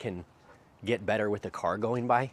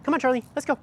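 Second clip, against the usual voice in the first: high, louder, bright call to get the dog moving; chirpy high-pitched 'come on' to the dog; upbeat call to the dog; 6 dB louder, much faster than usual. Real, not acted. Calling the dog to walk.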